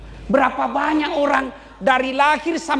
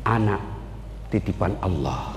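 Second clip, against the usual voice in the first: low, quiet voice of faith; Ayub low and calm; 5 dB quieter than usual. Acted. Ayub answering Satan, low and quiet. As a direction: say it with deep peace. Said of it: "Apa kata Nabi Ayub?".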